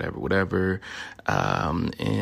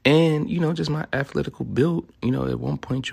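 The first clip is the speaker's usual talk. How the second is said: boastful; 8 semitones higher, 4 dB louder than usual. Acted. Bragging, hammed up.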